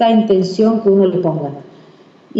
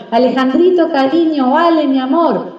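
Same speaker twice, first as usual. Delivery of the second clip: higher, doting pet names; good-humoured affection; 5 semitones higher than usual. Acted. Parent in a good mood addressing the child, affectionate. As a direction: coo sweetly to a child, pitched up. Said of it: "el padre está de buen humor".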